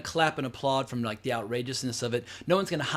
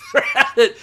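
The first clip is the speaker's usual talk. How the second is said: laughing; 10 dB louder than usual. Real, not acted. Laughing at her three accents.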